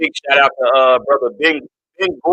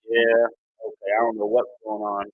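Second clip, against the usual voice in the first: ordinary delivery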